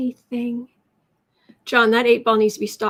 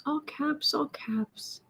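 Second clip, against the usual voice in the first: whisper; 3 semitones higher, 8 dB quieter, much slower than usual. Real, not acted. Whispering while reading chat.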